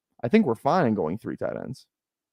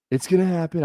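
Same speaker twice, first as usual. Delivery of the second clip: higher-pitched presidential impersonation; playful impersonation, pitched up; Donald Trump; 7 semitones higher than usual. Acted. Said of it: "I like doing the Trump accent".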